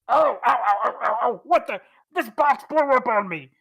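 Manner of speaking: loud, high yelps of pain from a dazed brute; hurt hippo man. Acted. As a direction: yelp and whine like a big lug who got blasted. Hippo man hit by blast.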